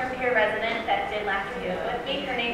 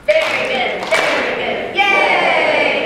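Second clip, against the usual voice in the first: loud chanted cheer, yay shouted; group cheer; 7 semitones higher, 12 dB louder, much slower than usual. Real, not acted. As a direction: lead the room in a big shout. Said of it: "let's do our very good".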